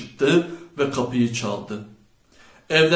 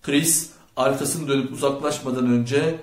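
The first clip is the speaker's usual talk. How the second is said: ordinary delivery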